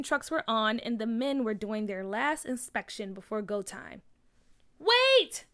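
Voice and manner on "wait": high, loud yell to stop them; girl yelling at the demolition crew, high and loud. A narrator's reading. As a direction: shout it out to stop the trucks. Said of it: "McKinley yelled".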